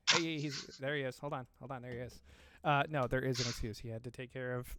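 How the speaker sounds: normal talking voice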